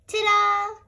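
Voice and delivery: high, bright show-off ta-da; triumphant ta-da. A narrator's reading. Teacher finishing the obstacle course, triumphant. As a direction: present it like a finished trick. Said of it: "she cried".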